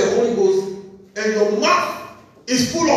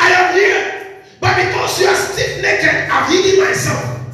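voice of the Lord; 9 semitones higher, 4 dB louder than usual. Retold or imitated. Preacher speaking as the Lord to stiff-necked people, high and solemn. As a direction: proclaim it as God answering. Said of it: "the Lord is saying".

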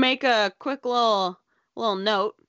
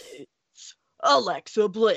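gravelly announcer voice. Shown as a demonstration. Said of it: "You need a little gravelly".

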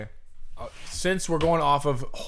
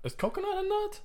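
squeaky voice; 7 dB quieter than usual. Pretend. Puzzled question in squeaky voice.

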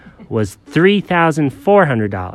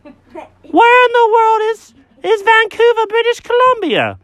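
squeaky disbelief; 4 dB louder than usual. Acted. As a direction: squeal out the confused question. Jenkins shrieking high.

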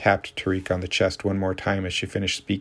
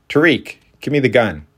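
sharp, louder and higher command; urgent demand; 5 semitones higher, 7 dB louder than usual. A narrator's reading. Mary to Tariq holding a gun, a sharp urgent command. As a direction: snap the order out to stop him.

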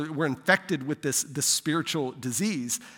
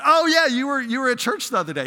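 loud, high "oh yeah" of recognition; acted-out recognition; 9 semitones higher, 7 dB louder, faster than usual. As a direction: exclaim as if it just clicked, then hurry on. Acts out his sudden recognition.

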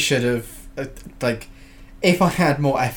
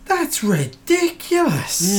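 incredulous; 8 semitones higher, much slower than usual. Real, not acted. Reacts to Soviet ban.

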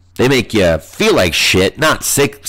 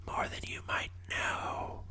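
hushed, breathy whisper; voiceless stage whisper; conspiratorial whisper; 24 dB quieter than usual. Pretend. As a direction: whisper it like a secret. Echoes the line as a whisper.

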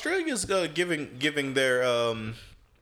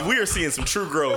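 ordinary delivery

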